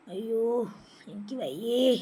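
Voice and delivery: soft, worn-out moan; feeble complaint.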